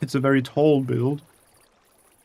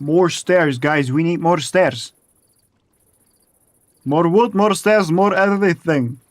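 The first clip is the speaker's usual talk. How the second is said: louder, pushy shouting for more; loud demanding call; 3 semitones higher, 7 dB louder than usual. Real, not acted. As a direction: bark the list like orders. Calling out demands to viewers.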